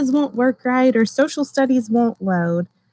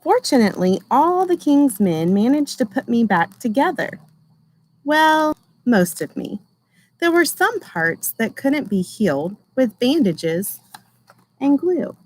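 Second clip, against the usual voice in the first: gentle storybook reading voice; reading to children. Acted. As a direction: read it like a picture book for a class. Teacher reads picture book aloud.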